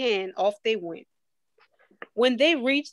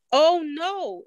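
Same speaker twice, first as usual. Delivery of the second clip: gasped; 6 semitones higher, 3 dB louder than usual. A narrator's reading. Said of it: "Ava's mother gasped".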